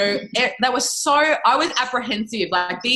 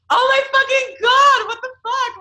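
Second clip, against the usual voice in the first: high, loud shocked scream; shrieking disbelief; 5 dB louder than usual. Retold or imitated. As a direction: shriek it like you just won. Hearing she won the trip.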